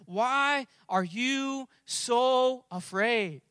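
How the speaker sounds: long held words with spaces between; very slow, deliberate preaching delivery; slow, weighty question. Acted. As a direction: draw out every word as a searching challenge. Repeats the scripture question for emphasis.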